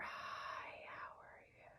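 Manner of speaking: breathy exhaled whisper; airy demo whisper. Shown as a demonstration. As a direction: sigh the words out on the breath. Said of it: "and you just whisper".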